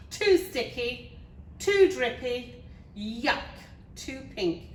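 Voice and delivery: fussy princess rejecting things; exclaimed complaint. A narrator's reading. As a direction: exclaim each fault separately, drawn out. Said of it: "she exclaimed".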